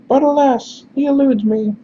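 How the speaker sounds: falsetto lament, falling at the end; high-pitched, pained character. Acted. Messenger laments his failed search, high-pitched voice. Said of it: "looks at you like he is in pain".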